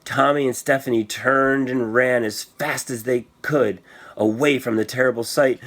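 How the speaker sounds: ordinary delivery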